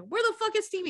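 high-pitched urgent question; mock frantic search. Retelling a boy running outside searching, frantic.

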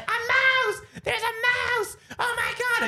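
very high cartoon shriek of panic; screaming Natalie. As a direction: squeal it in a mock-terrified falsetto. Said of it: "but she's screaming".

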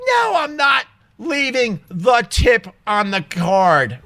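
high, slow, emphatic no; indignant refusal. Retold or imitated. Customer refusing to tip the cashier, indignant.

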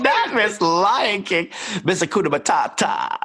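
high, drawn-out mock name-calling; playful mockery. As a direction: tease with silly nicknames, high and laughing. Teasing nicknames amid laughter.

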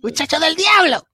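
loud, high-pitched hammed-up scolding; mock parental scolding. Playing a parent cursing the kid over TV noise, mock yelling. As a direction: yell it like an exasperated Spanish parent.

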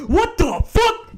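slow, high, loud what the fuck; stunned disbelief. Real, not acted. Storyteller stunned by his own story, disbelieving. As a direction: drag it out in shock.

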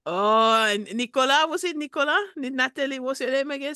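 lightly put-on French accent; a hint of a Parisian caller; mild mock French accent. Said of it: "dialing code of my accent".

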